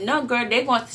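ordinary delivery

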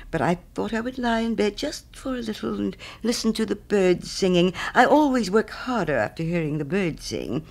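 earnest little fellow excusing his lie-in; apologetic little Hans. Acted. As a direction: explain meekly and wistfully. Hans in the story.

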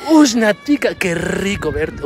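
slow, eager Colombian "qué rico verte"; Colombian greeting. Retold or imitated. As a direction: say it with great gusto, drawing out "rico" slowly. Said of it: "con una enjundia, con unas ganas". (saludo colombiano con enjundia, lento)

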